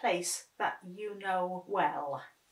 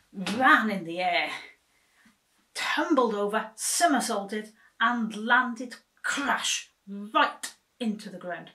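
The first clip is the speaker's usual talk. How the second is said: excited; 2 semitones higher, 8 dB louder than usual. A narrator's reading. Tumbling landing narrated.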